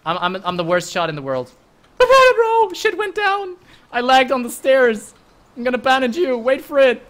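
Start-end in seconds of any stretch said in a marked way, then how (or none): 2.0-7.0 much higher, louder, laughing through it; excited laughing rush. Real, not acted. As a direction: babble it out still buzzing. Laughing after the chaos.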